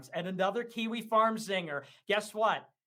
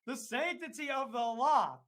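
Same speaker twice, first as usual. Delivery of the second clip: mock pompous voice; 4 semitones higher, slower than usual. Mocking rival's law talk.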